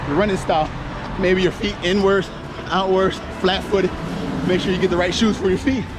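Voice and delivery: ordinary talk, nothing marked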